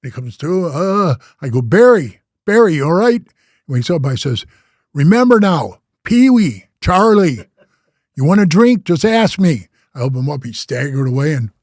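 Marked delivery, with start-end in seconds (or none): 1.6-3.2 loud call to a knocked-out man. Retold or imitated. 4.9-9.7 louder, pointed reminder; pointed lesson. Retold or imitated. Lecturing the beaten man.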